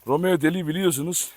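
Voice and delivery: usual speaking voice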